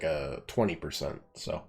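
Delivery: usual speaking voice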